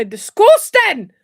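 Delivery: loud, drawn-out Scottish outburst; Scottish accent. Acted. Quoting it for listeners, loud Scottish accent. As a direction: belt it in broad Scottish, stretching it slowly. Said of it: "I do the Scottish whenever you say".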